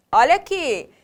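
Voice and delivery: normal talking voice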